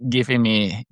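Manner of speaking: usual speaking voice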